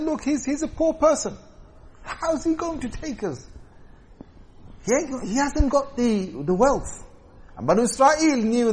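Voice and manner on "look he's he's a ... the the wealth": high-pitched complaint, imitating doubters; retold scornful objection. The people tell Samuel he is too poor, mock protest.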